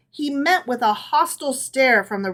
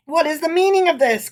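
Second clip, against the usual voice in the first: higher, louder astonished outburst; astonished cry; 6 semitones higher, 5 dB louder, faster than usual. A narrator's reading. Rabbi bursting in on shouting women, astonished cry. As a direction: burst out in shock. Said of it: "cried the rabbi in astonishment".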